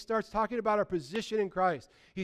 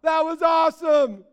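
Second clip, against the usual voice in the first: high, loud burst of awe; amazed exclamation; 8 semitones higher, 10 dB louder, slower than usual. Acted. Believer amazed at what God did, high excited shout. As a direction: cry it out, thrilled and astonished.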